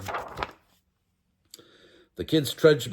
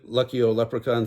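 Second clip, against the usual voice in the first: plain reading voice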